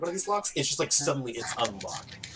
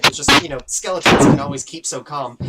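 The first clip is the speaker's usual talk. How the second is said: louder, emphatic joke set-up; loud build-up; 12 dB louder than usual. Acted. Skeleton sets up a pun mid-apology, loud and emphatic. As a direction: build volume toward the punchline.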